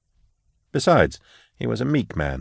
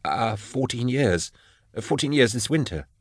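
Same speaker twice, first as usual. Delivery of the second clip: nervous hesitant answer; 4 semitones higher, 6 dB quieter than usual. A narrator's reading. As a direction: mumble it timidly, unsure.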